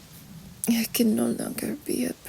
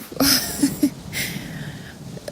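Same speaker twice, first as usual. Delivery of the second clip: relieved laugh; 2 semitones higher than usual. Acted. Partner laughing with relief at her love's kindness. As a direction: let out a light laugh of relief.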